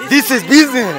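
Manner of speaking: mock-proud declaration; playful boast in English. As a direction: announce it like a tycoon. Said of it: "देख रहे हो business".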